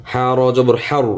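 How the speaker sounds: ordinary talk, nothing marked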